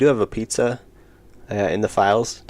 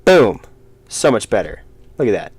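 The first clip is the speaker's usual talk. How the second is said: excited; 7 dB louder than usual. Real, not acted. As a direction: announce it like a big reveal. Proud reveal of finished art.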